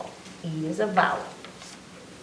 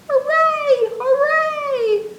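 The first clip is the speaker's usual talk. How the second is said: cheering; 7 dB louder, much slower than usual. Acted. Cheering in the rhyme.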